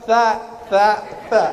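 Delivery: THA repeated singly, tongue tip out; isolated repeated tha; practice drill. Shown as a demonstration. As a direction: keep drilling "tha", one at a time. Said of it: "Latihan bolak-balik ke rumah".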